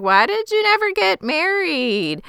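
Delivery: high panicked question; freaking out. Retold or imitated. Daughter confronting Charlie, panicked and high. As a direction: voice jumps up in alarm. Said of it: "And she is freaking out".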